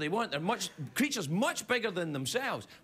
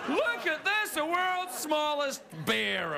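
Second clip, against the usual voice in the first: showman boast; 9 semitones higher, slower than usual. Retold or imitated. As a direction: present it like a fairground barker. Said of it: "then goes".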